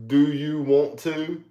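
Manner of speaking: slow Southern drawl on do you want to; slow, drawled Southern question. Retold or imitated. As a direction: stretch it out like an old Southern local. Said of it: "like the old school".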